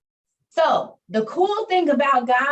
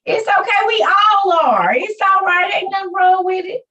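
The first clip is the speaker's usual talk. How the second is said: reassuring; 9 semitones higher, 7 dB louder than usual. Real, not acted. Comforting a crying caller.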